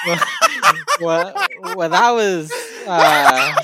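talking through laughter; words broken by laughing. Real, not acted. Laughing hard.

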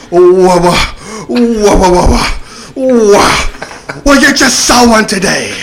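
loud, high sputter bursting into a shout; mock stuttering yell. Enraged officer stuttering at the driver, hammed-up high yell. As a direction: stammer on the first sound, then yell it out. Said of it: "he starts stuttering".

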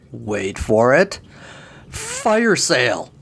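slowed-down big reveal, fire sale lifted; milk the punchline slowly; dramatic build-up. A narrator's reading.

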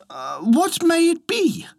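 very high-pitched wife character; curious wife. A narrator's reading. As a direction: ask curiously in a high storybook wife's voice. Wife answers husband's call.

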